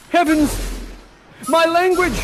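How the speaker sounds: high, loud astonished exclamation; Ferdinand. Acted. Ferdinand hearing the young lady speak, high loud amazement. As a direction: burst out in theatrical amazement. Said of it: "And he says".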